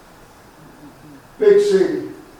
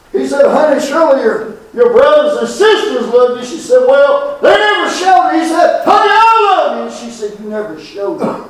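building sermon story; 6 semitones higher than usual. Acted. Retold dialogue climbing in pitch.

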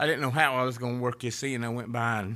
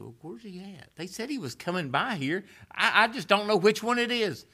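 higher, searching mock bewilderment; puzzled Zacchaeus; 12 semitones higher, 6 dB louder than usual. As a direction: act out a confused man peering down. Zacchaeus voiced wondering in tree.

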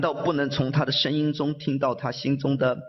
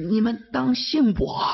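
pleading; 10 semitones higher than usual. Acted. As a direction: cry out for belief, high. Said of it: "他声音里头那个，那个声调".

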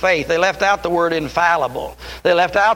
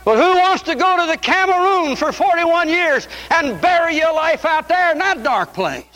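high, pleading pulpit cry; full-force preaching; 9 semitones higher than usual. Acted. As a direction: challenge the congregation with rising fervor.

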